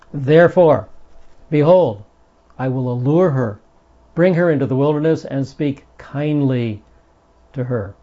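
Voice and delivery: "Therefore, behold" proclaimed loud, then easing to "speak kindly"; emphatic scripture reading. Shown as a demonstration. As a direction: start loud and insistent, soften at the end. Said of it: "this sentence is very, very emphatic".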